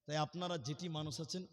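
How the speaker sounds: ordinary delivery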